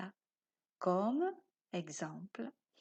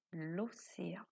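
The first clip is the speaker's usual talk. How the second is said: a hushed, airy naming of the session; whispered title; 5 semitones lower, 9 dB quieter than usual. Shown as a demonstration. Titre de la séance chuchoté. (titre chuchoté dans un souffle)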